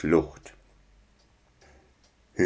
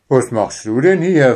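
surprised; 5 semitones higher, 5 dB louder than usual. Acted. Father surprised in pantry.

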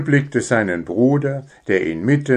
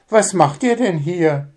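much higher mother's voice; mother surprised; 9 semitones higher than usual. Acted. Mother finds husband and son hiding, surprised. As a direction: ask like a puzzled mum.

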